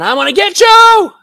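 loud, high-pitched threatening yell; screaming out. Pretend. Preacher showing what Jesus never cried on the cross, mock screaming. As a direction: scream it out like a vengeful threat, hammed up. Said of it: "do you ever hear him screaming out".

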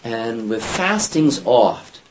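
And with fastings oft said slowly, each word leaned on; slow, weighted reading. Shown as a demonstration. Teacher stressing the King James wording, slowly. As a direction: read the true word out slowly and deliberately. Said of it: "but what's the word there?".

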